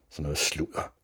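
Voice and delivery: low dismissive scoff; dismissive hiss. A narrator's reading. Newsreader rejects the boy's explanation, low scoff. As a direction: brush it off curtly. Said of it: "vridsede T V-speakeren".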